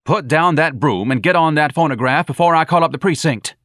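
loud, angry command across the room; hollered order. A narrator's reading. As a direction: holler it as a threat. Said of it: "Lana hollered at him".

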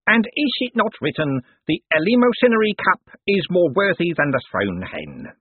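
high, declaimed scripture quote; sanctimonious reverend. A narrator's reading. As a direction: intone it like a pious preacher. Sham reverend quotes scripture to lady.

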